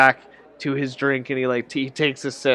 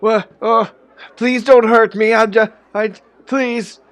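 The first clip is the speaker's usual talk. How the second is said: high, loud frightened pleading; old man begs his captors not to hurt him; begging; 8 semitones higher, 6 dB louder than usual. Acted. Old man begs while dragged.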